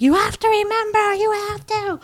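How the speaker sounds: high, hammed-up wail; desperate pleading. Daughter dragged away begging her father, desperate pleading. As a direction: act out begging through distress. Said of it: "it's, like, very upsetting".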